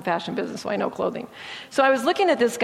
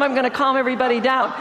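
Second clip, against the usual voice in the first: ordinary talk, nothing marked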